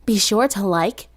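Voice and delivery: loud, bright sign-off to viewers; upbeat outro. Real, not acted. The narrator thanking her audience, loud and upbeat. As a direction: wrap up the video cheerfully.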